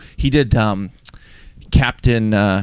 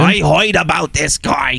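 crazy voice, higher and slow; Gilbert Gottfried; 5 semitones higher, slower than usual. Acted. Cohost showing off the parrot actor's crazy voice, comic impression. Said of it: "He's got the crazy voice".

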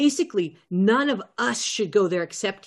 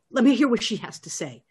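ordinary talk, nothing marked

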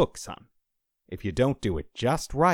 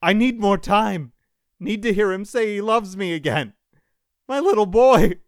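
high, loud anguished cries; desperate pleading; 14 semitones higher, 9 dB louder than usual. A narrator's reading. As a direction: shout pleas while being pulled away. Dying father pleads, still shouting.

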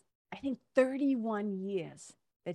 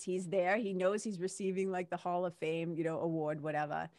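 ordinary talk

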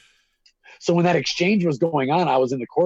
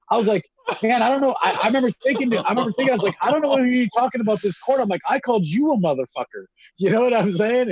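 excited retelling; 9 semitones higher than usual. Retold or imitated. Man telling friends how he answered the judge, excited and high. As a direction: pitch up high, rushing a bit. Heated retelling of courtroom outburst.

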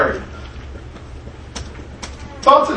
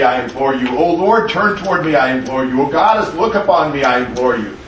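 solemn prayer reading; 4 semitones lower than usual. Acted. Teacher reads an ancient prayer to his class, solemn pleading reading. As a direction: read it like a pleading litany, steady and grave.

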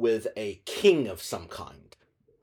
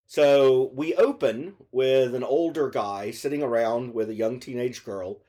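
normal talking voice

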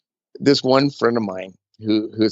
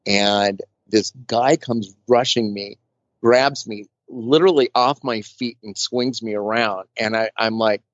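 ordinary delivery

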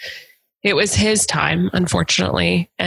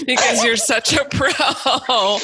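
laughing; 6 semitones higher, slower than usual. Real, not acted. Laughing at her own joke.

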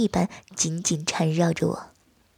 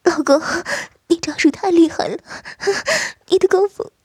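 wife crying out; 10 semitones higher than usual. Acted. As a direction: call out to him in a high voice, carried away. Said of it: "他叫声道".

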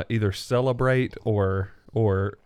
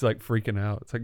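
ordinary delivery

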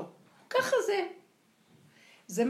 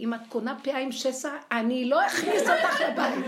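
louder, higher mock shout at the wife; husband yelling at his wife over a wig, mock louder shout; 4 semitones higher, 9 dB louder than usual. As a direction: ham up a husband yelling a threat. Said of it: "צועק לאשתו".

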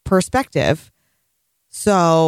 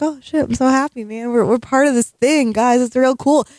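higher, eager kid voice, hammed-up; mock happy kids; 6 semitones higher than usual. Co-host mocking the child soldiers, higher and hammed-up happy. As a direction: play naive thrilled children joining a war. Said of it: "they're, like, all happy".

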